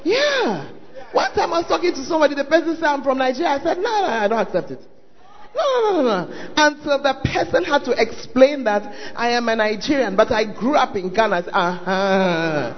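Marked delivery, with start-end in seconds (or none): none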